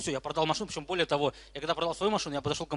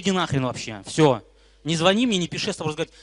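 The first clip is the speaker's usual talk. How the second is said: angry dismissal; 3 semitones higher, 8 dB louder than usual. Retold or imitated. Retelling himself telling them off.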